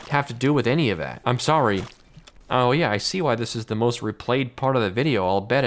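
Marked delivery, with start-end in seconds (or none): none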